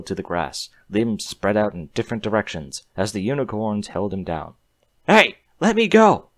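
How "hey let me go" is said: high, loud scream. A narrator's reading. Human pinned down by unicorn magic, screaming high. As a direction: yell to be let go. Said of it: "the human screamed".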